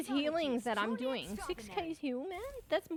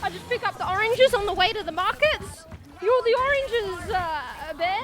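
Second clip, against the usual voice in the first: loud banter; 4 semitones higher, 9 dB louder than usual. Real, not acted. Gaming banter, mock insult.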